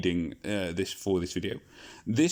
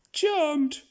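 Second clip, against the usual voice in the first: much higher voice, a coy lady saying charmed; coy lady greeting; 4 dB louder than usual. Acted. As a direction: purr it out as a prim young lady. Elizabeth greets the group.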